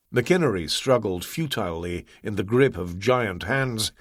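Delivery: plain reading voice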